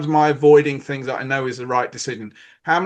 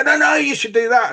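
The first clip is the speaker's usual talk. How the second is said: high-pitched exasperated objection; acting out an exasperated coach; annoyed; 10 semitones higher than usual. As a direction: cut in, annoyed, pitch jumping right up. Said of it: "and then you get annoyed".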